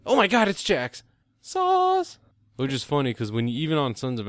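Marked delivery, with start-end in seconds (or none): 0.1-2.2 squealing much higher and louder; mock excited recognition. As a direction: burst out as the penny drops. Recognizing actor, acted excitement.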